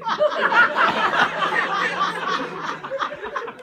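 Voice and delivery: loud high laughing fit; burst of laughter. Real, not acted. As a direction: crack up out loud. Cracking up mid scene.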